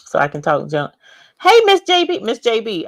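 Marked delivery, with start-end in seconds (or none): 1.4-2.9 high, loud calling out a name; excited greeting. Real, not acted. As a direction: sing out her name to welcome her. Excited livestream greeting to viewer.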